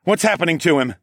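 high, loud urgent question; alarmed demand. A narrator's reading. Narrator demanding answers for stricken friend, alarmed. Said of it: "I demanded of the wolfmen".

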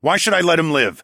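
angry challenge. Acted. Fighter challenges the sister over sparing mage, angry. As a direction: throw the question back angrily.

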